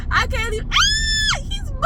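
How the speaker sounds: very high panicked shriek; real squealing fright; freaking out. Real, not acted. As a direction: scream as the creature moves. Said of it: "I'm gonna freak out".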